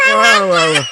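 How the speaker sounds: loud, laughing exclamation; laughing wow. Real, not acted. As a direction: shout it through laughter. Laughing at clip.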